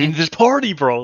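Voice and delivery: put-on high, loud kid voice; eager little brother. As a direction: play the pushy little brother.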